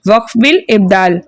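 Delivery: ordinary talk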